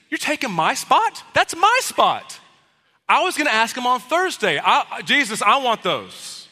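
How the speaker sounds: high, loud mock outrage, my spot stretched slow; hammed-up jealous indignation; indignant whine. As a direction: protest like a kid losing his seat. Disciples indignant over their spot.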